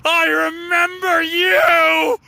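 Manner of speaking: high, slow, drawn-out scream; screamed cry from a damned soul in hell; vengeful shout. Acted. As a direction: wail each word out loud and high. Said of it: "you hear from behind you in the stereo speakers".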